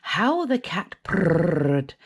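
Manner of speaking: ordinary delivery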